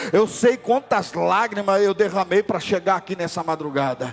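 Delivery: loud, high, slow impassioned preaching; preaching at full force. Acted. Preacher crying out to the congregation, loud and slow. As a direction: pour it out, building to a cry. Sermon climbing to climax.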